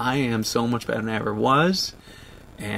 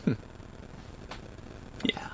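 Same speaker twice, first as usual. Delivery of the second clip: ordinary delivery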